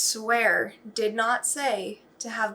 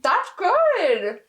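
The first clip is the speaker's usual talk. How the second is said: high, loud delighted squeal; 8 semitones higher, 5 dB louder than usual. Real, not acted. Baker tasting her loaf, delighted squeal. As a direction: savour it with a happy squeal.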